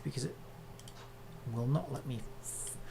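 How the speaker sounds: normal talking voice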